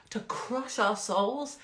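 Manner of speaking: drawn-out, doomy phrase; slowed, dramatic. Acted. Mocking the bad news shouted at viewers, drawn out. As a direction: stretch it out darkly.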